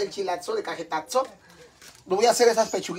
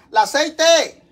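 loud, high cry of alarm; sudden alarmed shout; 9 semitones higher, 8 dB louder, much slower than usual. Real, not acted. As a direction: blurt it out as the oil spits. Oil suddenly burning on stove.

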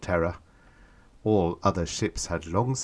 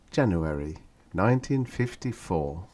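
ordinary delivery, nothing marked

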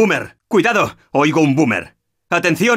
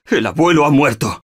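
ordinary talk

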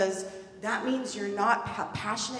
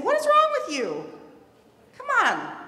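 much higher and louder, an exasperated yell; angry outburst; 13 semitones higher, 7 dB louder than usual. Pretend. Adult yelling at a struggling person, angry. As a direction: blow up at them in frustration. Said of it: "instead of saying".

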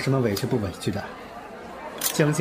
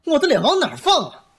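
higher and louder, offended; indignant outburst; 11 semitones higher, 5 dB louder than usual. Acted. Star angrily tells agent his face is at stake. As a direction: flare up, wounded pride.

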